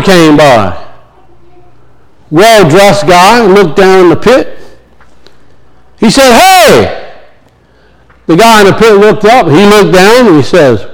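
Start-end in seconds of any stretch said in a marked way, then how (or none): 6.3-7.1 raised-pitch hail; mock holler from above; acted calling down. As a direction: call down to someone below, without shouting. Doctor calls down into pit.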